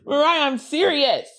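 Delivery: usual speaking voice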